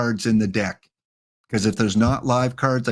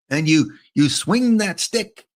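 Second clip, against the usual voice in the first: mock Canadian accent; 5 semitones higher than usual. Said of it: "Now I'm sounding like a Slapshot".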